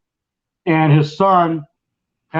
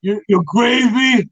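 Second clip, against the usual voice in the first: put-on [name]'s New York accent; 6 semitones higher than usual. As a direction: pester the waitress as a New York wiseguy. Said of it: "it's a stereotypical, uh, accent".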